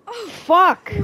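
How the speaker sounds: loud, high cry of real shock; shocked outburst. Real, not acted. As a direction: blurt it out loudly in alarm. Shocked reaction to game scene.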